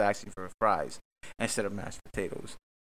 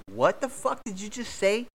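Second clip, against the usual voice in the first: higher, slow disbelieving retort; mock outraged boyfriend, much higher; incredulous; 11 semitones higher than usual. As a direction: stretch it out in disbelief. Acted quarrel, his own reply.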